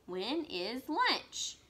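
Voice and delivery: When is lunch? drawn out very slowly, lunch stressed; slow teacher question demo. Shown as a demonstration. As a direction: read it slowly as a question for kids. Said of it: "Question mark".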